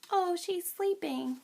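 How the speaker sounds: high, slow pretend voice; playful deer character. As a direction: answer slowly as a little toy deer, up high. Voicing the deer's answer.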